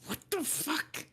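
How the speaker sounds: high, hushed exclamation of shock; startled outburst. Acted. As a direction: squeak out the disbelief under your breath. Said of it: "he goes".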